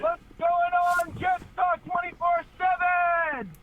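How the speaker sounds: loud, high, drawn-out caller's holler; hyped shouted greeting. Real, not acted. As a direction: stretch it out like a stadium hype man. Caller bellows greeting to the show.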